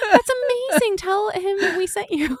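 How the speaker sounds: gasp, then high squealing delight; thrilled. Acted. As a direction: gush it high, drawing out the end. Thrilled the dragon knows their friend.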